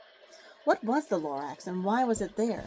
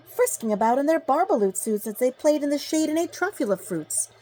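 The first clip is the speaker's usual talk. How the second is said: plain reading voice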